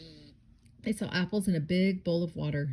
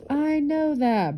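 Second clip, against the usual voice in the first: drawn-out, high, loud exasperated shout; loud storybook bear shout; 9 semitones higher, 8 dB louder, much slower than usual. A narrator's reading. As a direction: shout it, stretching the words out. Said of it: "Boris shouted".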